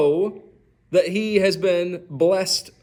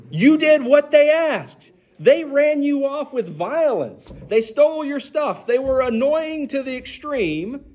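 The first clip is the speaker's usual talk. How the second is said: exasperated; 8 semitones higher than usual. Acted. Preacher voicing Isaac's exasperation.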